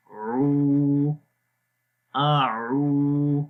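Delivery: "آعو" slowly stretched in a chanting recitation voice; teacher recites slowly for students; drawn-out recitation. Shown as a demonstration. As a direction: chant "آعو" long, the ain from mid-throat. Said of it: "عین کی آواز گلے کے درمیان والے حصے سے".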